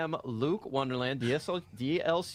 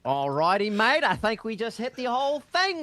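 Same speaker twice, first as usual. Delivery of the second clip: a light mock Australian accent, a bit louder; 8 semitones higher, 5 dB louder than usual. Host greeting co-casters in a light mock Australian accent. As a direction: throw in a touch of Aussie on mate. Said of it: "with our Australian accents today".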